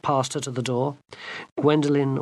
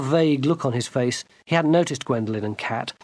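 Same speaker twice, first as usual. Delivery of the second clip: plain reading voice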